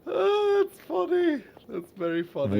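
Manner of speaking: laughing. Real, not acted. Laughing along at the trick.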